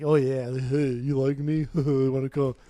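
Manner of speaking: a lightly voiced goofy bar guy hitting on a girl; mock come-on, only slightly put on. Mocking a drunk guy chatting up girls at a bar, lightly voiced. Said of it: "Goofy ass guy".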